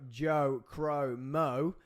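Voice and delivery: ordinary delivery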